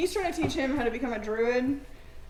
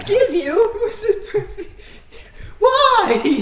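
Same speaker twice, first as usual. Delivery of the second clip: high, loud squeal of outrage; outraged high voice; 11 semitones higher, 11 dB louder, slower than usual. Acted. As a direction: squeak with indignation. Pie slapped from his hand.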